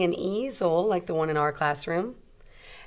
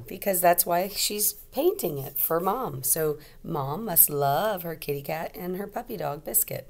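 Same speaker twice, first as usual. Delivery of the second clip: usual speaking voice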